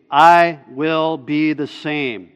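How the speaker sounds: "I" hit loud and held, the line slowed; emphatic. Shown as a demonstration. Preacher reading God's bolded promise to the congregation, emphatic. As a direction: punch "I", then spell it out slowly. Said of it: "Very emphatic statement".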